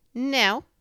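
high, loud put-on denial; disguised voice. A narrator's reading. Rabbit hiding who he is, disguised high voice. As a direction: push the pitch up to disguise yourself. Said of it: "in a different sort of voice this time".

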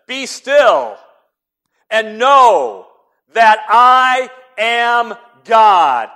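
very loud, high, drawn-out proclamation; booming shout. Shown as a demonstration. Preacher booming the verse as a shout, very loud. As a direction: thunder it out slowly. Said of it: "There wasn't a shout".

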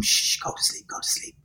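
put-on hushed whisper to a baby. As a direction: whisper it like soothing an infant. Hushing baby in buggy.

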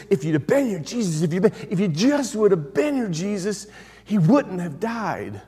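acted grief-stricken complaint; frustrated pleading. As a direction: plead in frustration, drawing out the last words. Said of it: "they're just frustrated with Jesus".

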